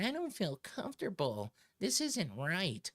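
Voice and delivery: mock crybaby whine. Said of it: "some stupid crybaby bitch decided".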